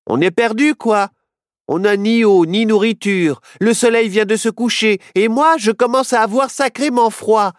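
complain, lost and cold; worried. Acted. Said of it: "s'inquiéta Nao". (Nao inquiet et grelottant)

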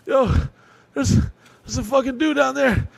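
high, shaky, breathless alarm; panicked kid. Retold or imitated. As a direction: tell it like a scared boy bursting in. Panicked kid telling stepdad.

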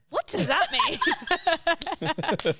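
high, drawn-out question through laughter; baffled laughing. Retold or imitated. Reacting to the man's leadership slides, baffled and laughing. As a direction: crack up while asking, very slowly.

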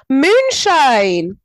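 loud, high, stretched-out shout; excited brainwave. Real, not acted. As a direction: shout it like a sudden bright idea. Calls out sudden idea.

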